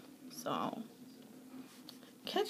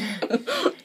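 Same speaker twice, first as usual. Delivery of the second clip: high giggle; 13 semitones higher than usual. Real, not acted. After disgusting burp.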